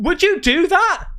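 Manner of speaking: high, loud disbelieving question; incredulous. Real, not acted. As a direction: react in shocked disbelief. Incredulous reaction to the story.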